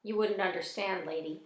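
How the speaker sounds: ordinary delivery, nothing marked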